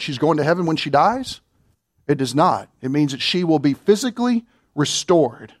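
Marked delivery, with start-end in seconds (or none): none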